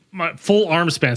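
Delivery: ordinary talk, nothing marked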